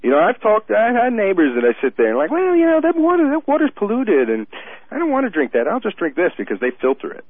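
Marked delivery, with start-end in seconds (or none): 2.3-7.2 mocking neighbor.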